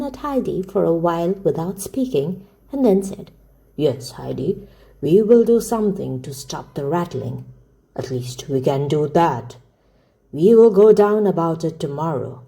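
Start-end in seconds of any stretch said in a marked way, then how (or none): 3.8-12.4 put-on low-pitched, deliberate old man's voice; steady grandfather. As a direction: answer the child as her reassuring grandfather, pitched down.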